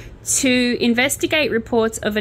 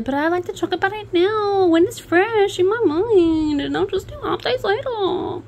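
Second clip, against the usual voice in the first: high, far-off snowflake voice; mock dainty voice, much higher; 11 semitones higher than usual. Shown as a demonstration. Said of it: "that was my, uh, snowflake voice".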